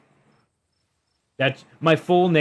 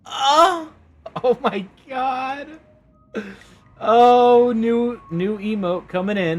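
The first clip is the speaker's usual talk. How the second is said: very high, amazed exclamations; real amazed delight; delighted gasps; 12 semitones higher, 6 dB louder, slower than usual. Real, not acted. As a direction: gasp at the new emote. Gasping at new emote.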